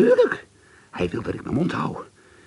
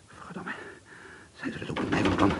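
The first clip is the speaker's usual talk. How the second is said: whisper; 5 semitones lower, 7 dB quieter than usual. Acted. Fearful whispered thought.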